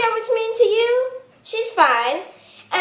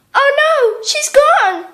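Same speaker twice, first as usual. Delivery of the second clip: alarmed gasp; 5 semitones higher, slower than usual. Acted. Sister notices Polly missing.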